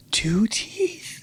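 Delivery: hushed, incredulous question; shocked whisper. Real, not acted. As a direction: whisper it in disbelief. Shocked reaction to the story.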